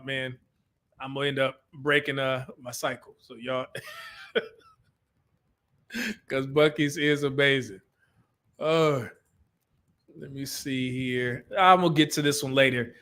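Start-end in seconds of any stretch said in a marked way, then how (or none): none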